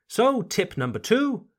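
ordinary delivery

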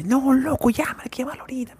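high, rushed whisper of an excited friend; urging whisper. Retold or imitated. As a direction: hiss it fast and high, like a friend pushing you. (susurro alto y apurado de amigo)

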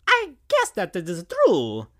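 lightly voiced Mario principal; a hint of Mario's voice. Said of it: "said the principal".

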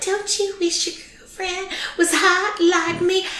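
put-on high, soft, little-old-lady singer voice; cute little voice. Woman imitating older karaoke singer for her co-host, cute little voice. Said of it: "she had a cute little voice".

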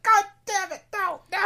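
very high, loud cartoonish outcry; mock anguished yell. Friend imagining gamer losing suitcases down a hill, mock anguished yell. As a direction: wail it in exaggerated despair.

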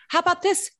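ordinary talk, nothing marked

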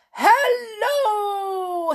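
high, loud booming reply; booming hello back. Acted. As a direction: boom back just as loud. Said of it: "he boomed back in a voice as loud".

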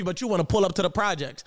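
ordinary talk, nothing marked

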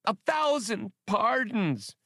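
high, slow, pleading alien wail. A narrator's reading. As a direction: beg forgiveness in a drawn-out wail. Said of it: "wailed the Silji".

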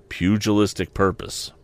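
plain reading voice

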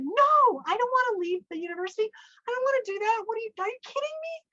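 high, fast, whiny refusal; frantic protest. Retold or imitated. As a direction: refuse loudly, then squeal in disbelief. Retells protesting to husband.

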